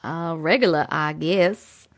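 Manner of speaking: low Brooklyn-style quote voice; New York accent. Retold or imitated. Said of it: "And he says".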